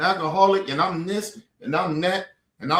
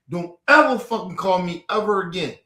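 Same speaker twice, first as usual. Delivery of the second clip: usual speaking voice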